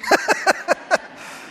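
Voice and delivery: quick unvoiced chuckle; short breathy laugh. Real, not acted. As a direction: laugh at your own joke. Laugh after sarcasm.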